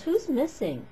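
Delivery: a teacher calling the roll, puzzled; teacher asking. Retold or imitated. Teacher taking attendance asks the class, lightly mimicked. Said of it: "he goes".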